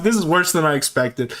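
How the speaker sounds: ordinary talk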